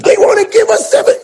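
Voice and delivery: a joyful yell, loud and high; excited shout. Retold or imitated. In the car celebrating the offer, an excited high yell. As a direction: shout it out like you just got rich.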